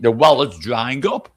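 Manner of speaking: ordinary talk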